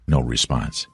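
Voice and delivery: ordinary delivery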